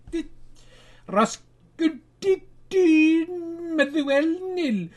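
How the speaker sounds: high, slow, hammed-up wail over the score; mock crying. Host lamenting a Scottish result to viewers, mock crying wail. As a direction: fake sob through the result.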